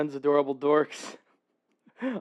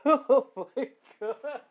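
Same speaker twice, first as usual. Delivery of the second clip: slow, high, laughing; 8 semitones higher, slower than usual. Real, not acted. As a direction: gasp it out mid-laugh. Laughing fit.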